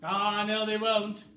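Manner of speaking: high, loud bossy bird voice; a high, loud, clever-bird voice; roly-poly bird voice. Acted. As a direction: squawk it like a know-it-all bird. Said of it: "said the roly-poly bird".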